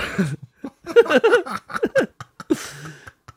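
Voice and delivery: loud, high, long real laugh; helpless laughter. Real, not acted. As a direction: dissolve into laughter. Laughing after capybara impression.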